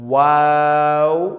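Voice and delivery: واو drawn out with lips rounded, not fully closed; sustained, recited واو; rounded-lip demonstration. Shown as a demonstration. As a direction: model a long level واو. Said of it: "جیسے".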